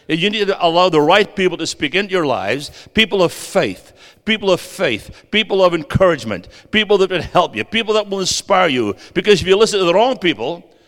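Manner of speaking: rising fervent preaching. Acted. Preacher warns the congregation about the wrong voices, fervent.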